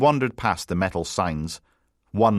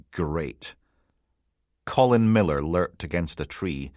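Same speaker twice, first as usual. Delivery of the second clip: plain reading voice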